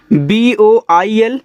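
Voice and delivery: spelling out letters. Shown as a demonstration. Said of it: "spelling out letters slowly".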